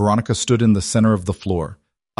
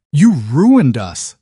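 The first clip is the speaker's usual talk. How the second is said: louder, slow, venomous hiss; hissed accusation; 7 semitones higher, 8 dB louder, much slower than usual. A narrator's reading. Damien accuses his ex-wife Isabella, slow loud venomous hiss. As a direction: spit it out slowly with hatred. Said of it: "Damien hissed".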